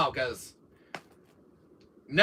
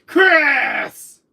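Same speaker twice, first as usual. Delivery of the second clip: high, loud villain bellow; hammed-up enraged shout; Wesker angrier; 10 semitones higher, 10 dB louder than usual. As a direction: roar the name in mock fury. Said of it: "When Wesker's more angrier, he says".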